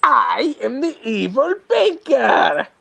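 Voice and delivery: high, slow, swooping villain voice; evil banker. Acted. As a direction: draw out a hammed-up evil banker. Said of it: "Imagine he talked like that".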